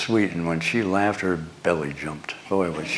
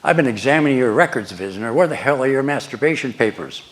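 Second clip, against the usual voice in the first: sharp, fast, louder sergeant's rebuke; firm, cross; 5 semitones higher, 5 dB louder than usual. Retold or imitated. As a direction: bark it like an annoyed first sergeant. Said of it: "he says to me really firmly, crossly".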